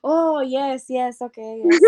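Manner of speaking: put-on high, eager-to-agree Indian accent; imitated Indian accent. Developer agreeing to the task, put-on Indian accent. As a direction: mimic an Indian coworker agreeing readily. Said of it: "And she'll be like".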